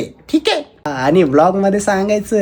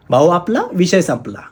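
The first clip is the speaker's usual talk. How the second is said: punchline delivery. Pretend. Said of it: "dialogue कसा वाटला?".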